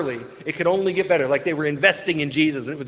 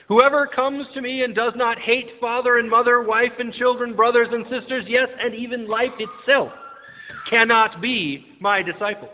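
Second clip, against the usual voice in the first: raised, declaimed gospel reading; scripture reading; 4 semitones higher than usual. Acted. Preacher reads the gospel highlights to the congregation, declaimed reading. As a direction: read the verse out firmly, higher and measured at the end.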